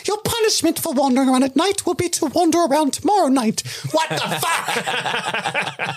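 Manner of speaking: high-pitched incredulous rant; mock outrage. Mock outrage at absurd punishment.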